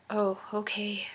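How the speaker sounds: hushed, near-whispered mock acceptance; retold meek, quiet response; deflated reply. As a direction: let it shrink to a small, soft reply.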